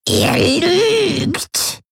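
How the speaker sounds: very high, cracked crone croak; shrill mad-hag rasp, falling at the end; croaking old woman. A narrator's reading. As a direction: screech it like a mad old grandmother. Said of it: "krächzte Voi".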